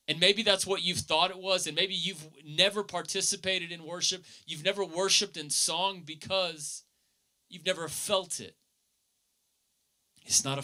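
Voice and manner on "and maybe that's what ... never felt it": earnest preaching. Acted. Preaching continues, raised pitch.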